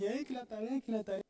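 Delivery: niño quejándose en voz baja; whiny child. Retold or imitated. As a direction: queja lloriqueada, suave. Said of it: "y lloraba". (imitación leve de un hijo que lloriquea por la tarea)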